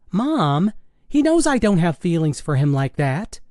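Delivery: high, exasperated daughter's voice; protesting teenage daughter. Acted. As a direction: protest to Mom in a girlish pitch.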